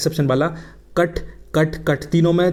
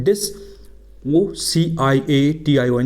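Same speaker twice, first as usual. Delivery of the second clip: letters spelt out slowly; slow spelling; much slower than usual. Shown as a demonstration. Teacher spells the word aloud for students. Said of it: "dissociation".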